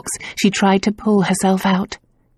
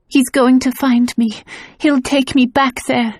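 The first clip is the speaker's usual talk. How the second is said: fearful; 6 semitones higher than usual. A narrator's reading. Frightened woman fearing the villain.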